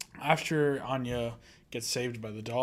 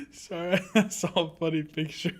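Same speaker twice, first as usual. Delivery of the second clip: real laughter breaking into a higher voice; laughing; 6 semitones higher than usual. Real, not acted. Co-host apologizing over a funny picture, laughing. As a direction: apologize through helpless giggles.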